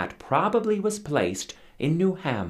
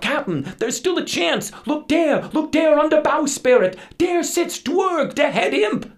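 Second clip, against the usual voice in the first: high, loud desperate pleading; frightened crew begging the captain to salute the imp; crew crying out; 8 dB louder than usual. Acted. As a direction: beg the captain over the wind. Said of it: "The crew then cried out".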